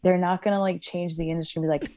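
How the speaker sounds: usual speaking voice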